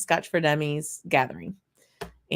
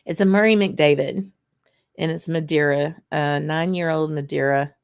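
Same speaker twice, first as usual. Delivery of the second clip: normal talking voice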